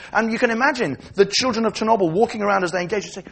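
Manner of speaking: ordinary delivery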